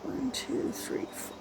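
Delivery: counting in a whisper. Real, not acted. Counting stitches under her breath.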